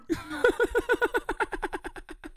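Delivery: high breathy laugh at his own bit; real laugh. Real, not acted. Streamer cracking up at his own accent, laughing.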